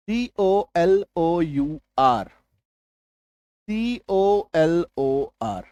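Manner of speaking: each letter of C O L O U R spelled out very slowly; slow letter-by-letter spelling. Shown as a demonstration. Teacher spelling two versions of colour for students, very slowly. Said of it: "confusion मैं आपको दिखाऊं".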